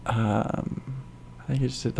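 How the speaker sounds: normal talking voice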